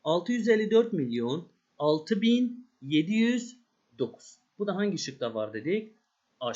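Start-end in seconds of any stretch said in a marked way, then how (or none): 0.0-4.4 slow, deliberate number reading; slow careful reading. Shown as a demonstration. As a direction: spell out each number slowly for the class. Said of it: "devamını okuyalım".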